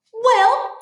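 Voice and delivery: huffy comeback. Acted. Tilly starts her retort to the insult, huffily. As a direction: come back at a friend in a high huff. Acted quarrel in a story.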